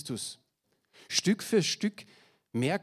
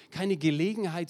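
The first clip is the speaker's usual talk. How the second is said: ordinary talk, nothing marked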